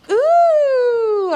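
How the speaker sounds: normal talking voice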